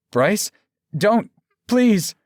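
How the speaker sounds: high, loud, halting plea; begging. A narrator's reading. Aaron begging the man he loved, desperate. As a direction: beg for your life, pitch soaring. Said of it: "Aaron was begging the man".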